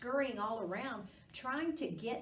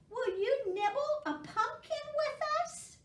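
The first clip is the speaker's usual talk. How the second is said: very high, small mouse asking; high little mouse inviting Squirrel; 4 dB louder than usual. A narrator's reading. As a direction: ask sweetly in a tiny mouse voice. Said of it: "said Mouse".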